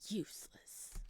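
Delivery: a breathless, toneless whisper of one word; eerie whisper. Acted. Demon whispering to Samuel, eerie whisper.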